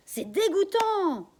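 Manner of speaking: high, loud, slow outraged shout; outraged cry. Acted. Daughter outraged at the bank bosses, loud shout. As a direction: spit out the disgust. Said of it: "je crie".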